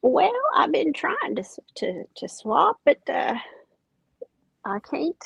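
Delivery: ordinary talk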